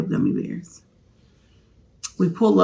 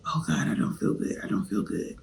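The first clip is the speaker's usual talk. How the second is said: weak, hushed moan, lower; sick whisper; 9 semitones lower, 9 dB quieter than usual. Retold or imitated. Waking up ill, whispering she feels bad. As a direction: barely get the words out. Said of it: "I got up and I was like".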